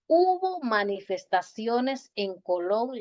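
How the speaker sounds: slow, careful "hubo manifestaciones en Colón"; slow teacher correction. Shown as a demonstration. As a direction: spell out the correct sentence slowly. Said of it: "se dice".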